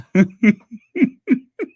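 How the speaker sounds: high nervous giggling fit; embarrassed laugh. Real, not acted. Host mortified at forgetting who sent the mug, nervous laughing. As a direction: break into helpless, embarrassed laughter. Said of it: "That's why I'm laughing".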